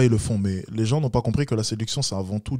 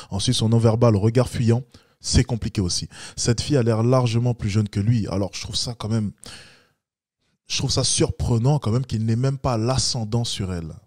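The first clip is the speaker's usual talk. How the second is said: ordinary talk, nothing marked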